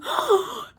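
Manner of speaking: high shocked gasp; storyteller's shocked gasp before no; big gasp. Retold or imitated. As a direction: gasp like the elephant in horror. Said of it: "He's going".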